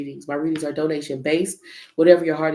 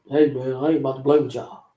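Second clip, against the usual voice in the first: lightly voiced low tough guy; 4 semitones lower than usual. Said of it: "you sound like [name]".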